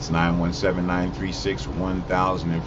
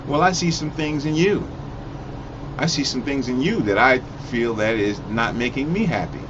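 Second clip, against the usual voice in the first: lightly voiced deflecting partner, higher; defensive narcissist comeback; 6 semitones higher than usual. Partner mimicking a narcissist's comeback, mildly defensive. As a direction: toss the blame right back, a touch higher. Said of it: "a narcissist will probably come back and say".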